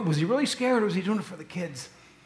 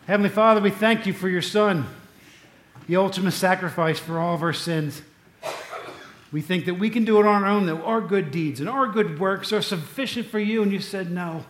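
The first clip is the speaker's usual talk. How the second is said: louder, earnest prayer voice; fervent pastor's prayer; 4 dB louder than usual. Acted. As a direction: pray over the congregation. Leads closing prayer.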